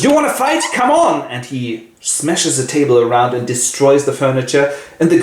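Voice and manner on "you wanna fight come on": challenging Paul. Acted. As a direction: dare the ghost to fight. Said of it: "Paul goes".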